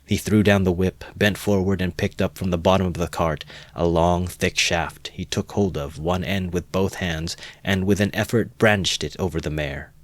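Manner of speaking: ordinary delivery, nothing marked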